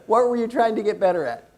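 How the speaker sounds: normal talking voice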